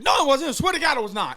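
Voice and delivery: very high, loud mock protest; frantic denial. Caught friend swearing innocence to accuser, frantic denial.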